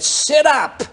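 commanding shout, retold; sharp command. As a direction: order a demon-possessed woman to obey. Commanding the possessed woman.

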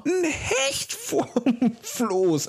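high, thrilled discovery; excited. Retold or imitated. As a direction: relive spotting the fish, very high. Continues excited retelling.

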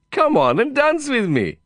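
very high, insistent plea; eager coaxing. Acted. Cowboy friend repeating his invitation, insistent and high. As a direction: insist in a high Indian-accented voice. Vikram urging Lin to dance.

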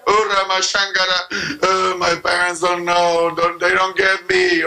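slow, drawn-out mock complaint in prayer; whining prayer. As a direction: plead like a misunderstood child, hammed up. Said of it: "Oh, that's your prayer".

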